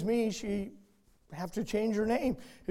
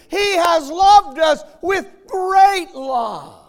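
much louder and higher, hammering each he loves you; rising fervour, voice near breaking on the verse; impassioned preaching; 9 semitones higher, 11 dB louder than usual. Acted. Preacher tells rebellious sinners God still loves them, fervent shout. Preacher's climax on God's love.

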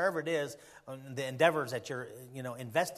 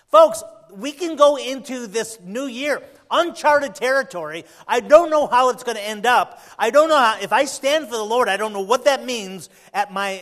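loud, raised, urgent sermon voice; full-force preaching; 6 semitones higher, 14 dB louder than usual. Real, not acted. Preacher to church about facing the new year, full-force preaching. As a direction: preach it out hard to the congregation.

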